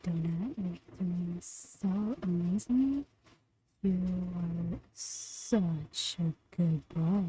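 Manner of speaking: soft and slow, like soothing a pet; soft, slow, reassuring praise for a good boy; tender affirmations. Acted. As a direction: murmur praise gently and slowly. Reading affirmations to Donut.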